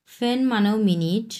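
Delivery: ordinary talk, nothing marked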